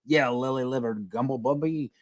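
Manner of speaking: a hint of old-timey cartoon villain insult; lightly voiced mock old-fashioned cussing; Mister Burns. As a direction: toss out a quaint, silly insult. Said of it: "it sounds like Mister Burns".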